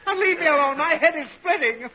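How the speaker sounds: high, strained, irritated yell easing into complaint; exasperated outburst. Acted. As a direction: snap at the child, head splitting. Hungover father snaps at pestering child.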